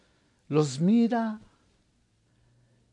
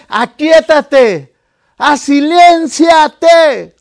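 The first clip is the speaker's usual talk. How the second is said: commanding the storm; 5 semitones higher, 10 dB louder, much slower than usual. Retold or imitated.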